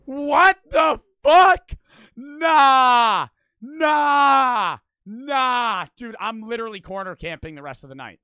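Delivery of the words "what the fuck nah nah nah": very loud, high, drawn-out yells; startled shouting. Real, not acted. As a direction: yell in shock, stretching every word. Jump scare in the horror video.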